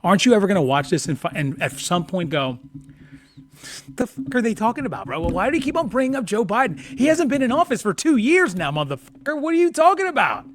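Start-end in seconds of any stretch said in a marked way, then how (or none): 3.9-10.4 high-pitched, incredulous mock rant; worked-up, baffled complaint; exasperated outburst. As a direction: act out fed-up disbelief, pitched way up. Acts out incredulous viewer's outburst.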